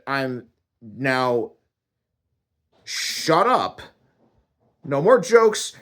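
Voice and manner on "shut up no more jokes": high, loud angry order; mad. Acted. God banning the man's jokes, angry. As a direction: lay down the law crossly. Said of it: "he was mad".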